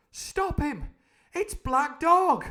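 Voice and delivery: high, loud urgent yell; crying out. Acted. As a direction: shout it across the pub to stop him. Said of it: "I cried".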